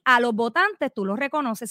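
usual speaking voice

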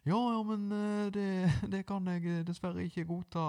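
pitched well up, playing a stranger on the phone. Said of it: "skifte på stemmen".

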